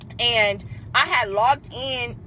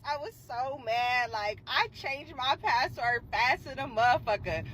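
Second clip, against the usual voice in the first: ordinary delivery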